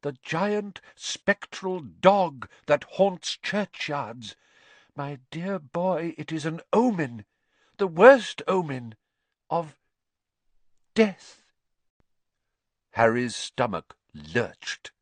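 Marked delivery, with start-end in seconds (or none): 0.0-11.4 high, slow, portentous prophecy; doom-laden seer. Acted. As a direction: draw out the omen with long dramatic pauses.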